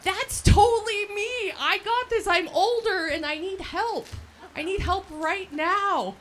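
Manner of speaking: high, loud, slow and eager; excited. Retold or imitated. Applicant recalling her reaction to the scholarship post, excited, high and loud. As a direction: reenact the rush of finding the scholarship. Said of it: "And I'm like".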